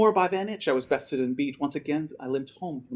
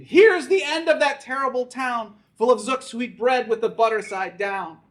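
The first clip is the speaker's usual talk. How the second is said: higher, loud defiant cry; screamed threat; 9 semitones higher, 5 dB louder than usual. Acted. Grandpa on the wall yells at the enemy town, loud cry. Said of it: "He screamed".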